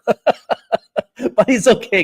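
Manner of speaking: high laugh running into the words; chuckle, then reassure them through it; laughing reassurance. Real, not acted. Laughs off audience's overexcited mistake.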